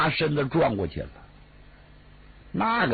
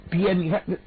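plain reading voice

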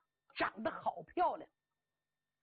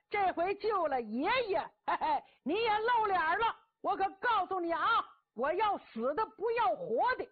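gloating bluster; 7 semitones higher, 3 dB louder than usual. Acted. Cheng Yaojin gloats to rescuer.

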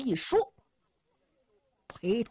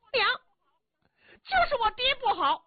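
put-on aggrieved boy. Son complaining about his father to his mother, high and hurt. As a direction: blame the father like a hurt child.

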